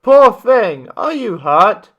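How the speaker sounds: ordinary delivery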